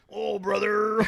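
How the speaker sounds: high, loud wrestler impression; Randy Savage. Host mimicking his friend's impression, hammed-up wrestler voice. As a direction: bellow it like a macho wrestler. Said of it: "that was your impression of him".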